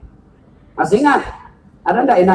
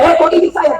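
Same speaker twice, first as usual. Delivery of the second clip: mock startled mother; 7 semitones higher than usual.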